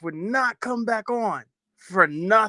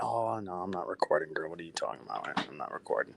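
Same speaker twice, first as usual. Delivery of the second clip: much softer, hushed words to his daughter; hushed reassurance; 12 semitones lower, 13 dB quieter, faster than usual. Retold or imitated. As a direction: quietly insist you're not recording. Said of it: "I'm like".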